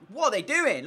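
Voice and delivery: exasperated outcry. Real, not acted. Gamer exasperated at his defenders, high loud outcry. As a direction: cry out in disbelief at the defence.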